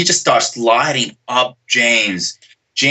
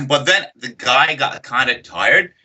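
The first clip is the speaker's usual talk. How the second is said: ordinary talk, nothing marked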